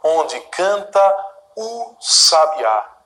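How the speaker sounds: slow, measured poem reading; poem recitation. Shown as a demonstration. Teacher reciting Gonçalves Dias to students, slow and measured. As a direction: recite each line slowly for the class.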